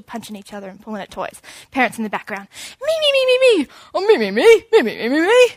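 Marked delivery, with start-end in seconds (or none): 2.8-5.5 put-on very high squealing child; kids squabbling. Two kids fighting over toys, very high me squeals. Family scene chaos.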